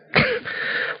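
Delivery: quick amused laugh; a brief real laugh at the memory; short laugh. Real, not acted. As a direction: let a laugh slip out. Laughing at the soldiers' looks.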